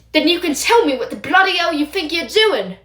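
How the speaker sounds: angry scolding. Acted. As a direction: tear into him. Teacher scolds Remus.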